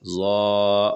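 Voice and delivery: ظا thick and heavy, tongue edge on upper teeth; emphatic ظ in ظا; heavy zaa. Shown as a demonstration. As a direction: make ظا full and heavy. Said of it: "آواز موٹی ہوتی ہے".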